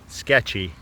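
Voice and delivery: usual speaking voice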